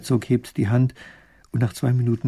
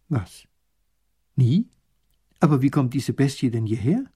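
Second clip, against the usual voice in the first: puzzled, sharply rising questions; mildly bewildered questioning; confused; 4 dB louder than usual. A narrator's reading. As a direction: ask in baffled disbelief. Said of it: "fragt verwirrt der kleine Großherzog".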